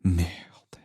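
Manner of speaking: breathless whispered French curse. A narrator's reading.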